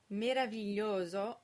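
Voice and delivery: meraviglioso stressed on the O of "glio", all vowels closed; second-to-last syllable stressed. Shown as a demonstration. Teacher modelling an Italian example word, meraviglioso with penultimate stress and closed vowels. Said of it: "the stress is on the second to last syllable".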